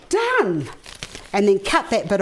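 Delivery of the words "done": high bright cry of triumph; excited. Real, not acted. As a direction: celebrate finishing the tie. Triumphant finish of tying.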